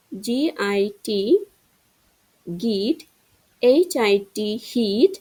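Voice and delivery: slow spelled letters, then the word; spelling drill. Shown as a demonstration. Teacher spells words for pupils to repeat, slowly. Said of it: "আমি যেরকমভাবে বলতেছি".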